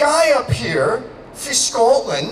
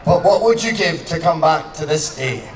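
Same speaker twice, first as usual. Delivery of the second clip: Braveheart speech; 5 semitones lower, slower than usual. Acted.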